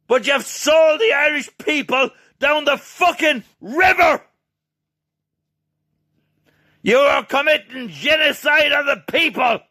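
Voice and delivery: shouted, high and loud throughout; sustained angry yelling; furious rant. Real, not acted. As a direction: rage at them with everything. Ranting at politicians, shouting.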